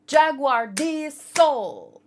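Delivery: each word drawn out and loud; slow emphatic name. Real, not acted. Naming her favourite character with slow, loud emphasis. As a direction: land the name slowly, like a reveal. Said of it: "I wanna point out".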